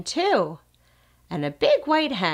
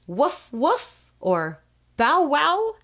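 high, playful barking, slower on bow wow; dog bark; 8 semitones higher, 4 dB louder, slower than usual. Pretend. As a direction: bark like a puppy for the child. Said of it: "What does a dog say?".

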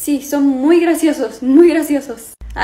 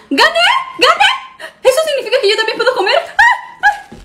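high, laughing victory yell; excited shout; 13 semitones higher, 3 dB louder than usual. Acted. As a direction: scream with joy at winning.

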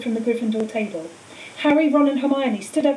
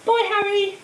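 much higher called farewell across the crowd; calling out; 10 semitones higher, slower than usual. A narrator's reading. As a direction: call out a goodbye over the crowd. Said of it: "Someone called".

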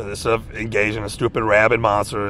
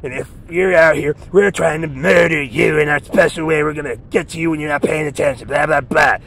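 menacing monster voice; 4 semitones higher than usual. Retold or imitated. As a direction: ham up the stranger's murder threats. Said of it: "it's just like".

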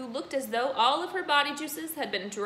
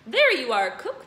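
crying out; 3 semitones higher, 10 dB louder than usual. Acted. Headmistress crying out to the cook. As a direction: cry out to the cook. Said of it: "the Trunchbull cried".

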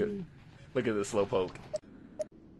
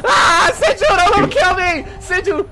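half-laughing panicked screams, much higher; being chased; 12 semitones higher, 10 dB louder than usual. Real, not acted. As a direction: shriek for help, laughing. Monster attacking, screaming and laughing.

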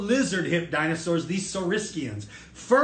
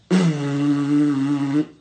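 loud, steady buzzing hum through a tube; lip-buzz tube noise; 3 semitones lower, 6 dB louder than usual. Shown as a demonstration. Teacher showing how a crest makes sound, loud tube buzz. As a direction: blow a droning honk through a tube. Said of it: "why does it make that noise".

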